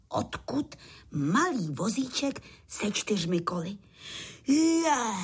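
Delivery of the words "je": high, loud cry of wonder; amazed exclamation. A narrator's reading. All the bugs amazed at the little cart, exclaiming. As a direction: exclaim it as an astonished crowd. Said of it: "zvolali všichni s údivem".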